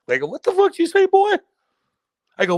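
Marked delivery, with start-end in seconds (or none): 0.3-1.3 high, loud mock outrage; imitating an indignant yell, pitched way up; retold angry challenge. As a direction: re-enact squaring up to a heckler. Retold confrontation with chirping opponent.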